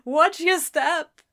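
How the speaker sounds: condescending sing-song warning; mocking call. A narrator's reading. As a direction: rub in his fall. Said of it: "she cried, laughing".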